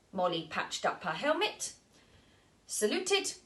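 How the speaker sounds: plain reading voice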